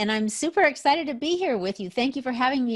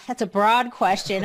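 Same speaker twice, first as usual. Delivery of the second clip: ordinary talk, nothing marked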